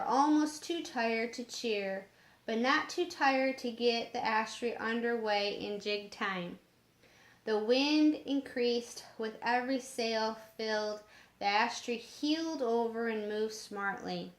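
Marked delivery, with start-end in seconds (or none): none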